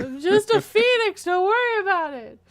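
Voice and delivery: high, loud and laughing. Pretend. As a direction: laugh it out in mock modesty. Playful mock reassurance.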